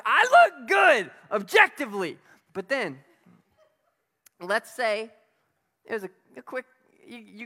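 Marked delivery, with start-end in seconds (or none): 0.0-2.2 strut it like a show-off; mock vain boast. Imitating a vain person bragging about their look, shouted.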